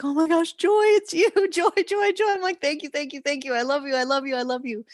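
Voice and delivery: high, loud, overjoyed gushing with a laugh; ecstatic joy. Retold or imitated. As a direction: greet her like a long-lost love. Joyful reunion with guide.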